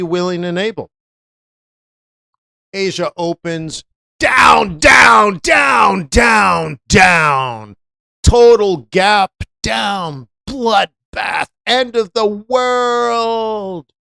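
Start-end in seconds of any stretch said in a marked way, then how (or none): 2.7-7.8 louder, rising shouted down, down; dramatic crash call. Acted. 8.2-13.9 slow, higher hammed-up doom; mocking the market panic, hammed-up doom. Mock doom about the market.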